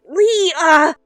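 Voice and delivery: ordinary delivery, nothing marked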